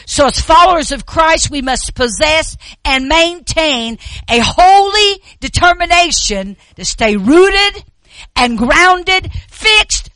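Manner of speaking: loud, high, impassioned preaching with a cry; full-force preaching. Real, not acted. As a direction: preach it with rising fervor.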